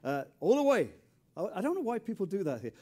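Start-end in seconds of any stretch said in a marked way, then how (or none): 0.4-0.9 mock Texan welcome; imitating local folks. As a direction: mimic how the people here greet you. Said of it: "people say".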